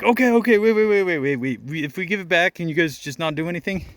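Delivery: imitating scared teens backing down fast; panicked pleading. Panicking teens begging to stop.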